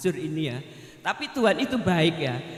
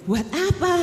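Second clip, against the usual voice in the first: high, loud startled "Buat apa?"; startled question; 10 semitones higher, 5 dB louder than usual. Retold or imitated. Retired woman recalling her son's sudden gift, startled and high. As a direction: ask it in sudden shock, pitched up high. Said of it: "Loh, saya kaget loh".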